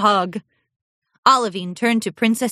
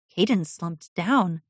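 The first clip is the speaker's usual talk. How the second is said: ordinary delivery, nothing marked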